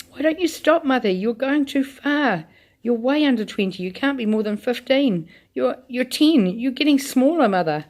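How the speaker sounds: begging her mother to stop; shrill alarm. Acted. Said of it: "a shrill note of alarm in her voice".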